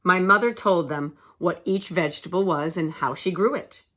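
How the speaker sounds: ordinary delivery, nothing marked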